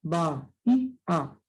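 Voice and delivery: "Ba, y, A" as three separate beats; syllable by syllable. Shown as a demonstration. Teacher showing hiatus in bahía, syllables pulled apart. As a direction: separate each vowel in "Ba, y, A". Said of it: "se separan".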